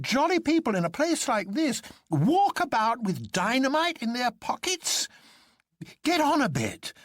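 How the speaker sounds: high, cheerful, stubborn doctor; a jolly man who refuses to believe it, high; jolly Doctor Bull. A narrator's reading. Bull's voice held in narration.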